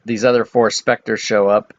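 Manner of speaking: ordinary talk, nothing marked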